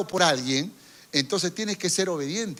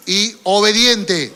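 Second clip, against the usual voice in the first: slow, much louder shouted reply; loud response; 3 semitones higher, 10 dB louder, slower than usual. Acted. Congregation answers the neighbour with a slow, loud shout. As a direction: shout it back with force. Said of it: "Respóndele".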